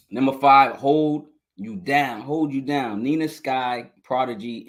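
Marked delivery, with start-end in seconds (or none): none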